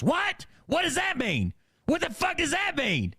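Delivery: high, shrieking mock outrage; hammed-up indignant screech; outraged. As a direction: explode like an offended mom. Mom erupts at son's remark.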